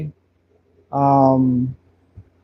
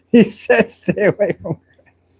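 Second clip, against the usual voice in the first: high, squeezed out through laughter; real laughter breaking into the words; laughing; 4 semitones higher than usual. Real, not acted. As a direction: crack up while repeating the comment. Cracking up at chat comment.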